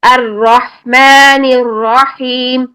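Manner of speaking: الرحمن الرحیم slow with full heavy ra; slow Quranic recitation; tajweed recitation. Shown as a demonstration. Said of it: "کیسے؟".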